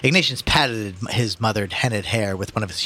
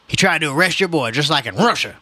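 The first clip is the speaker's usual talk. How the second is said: lightly voiced protesting old man; agitated old man; 5 semitones higher, 4 dB louder than usual. A narrator's reading. Old man warning the mother, agitated. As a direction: start raised and higher, press the accusation.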